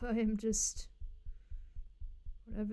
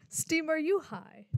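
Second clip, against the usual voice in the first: louder, higher repeat through a laugh; amused yell; 5 semitones higher, 5 dB louder than usual. Real, not acted. Streamer repeating the jab at Steam, amused and loud. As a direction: call it out again, amused.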